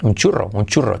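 "churro" in a bad-Spanish English accent, low. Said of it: "como un inglés que habla español mal". ("churro" con acento inglés)

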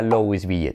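LOUISVILLE as "lo-wis-BI-ye"; Spanish spelling reading. Shown as a demonstration. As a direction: read LOUISVILLE as written in Spanish. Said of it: "cómo se pronuncia".

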